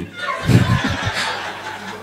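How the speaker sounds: squealing burst of laughter; very high breathy laugh. Real, not acted. Comedian squealing with laughter at the memory verse.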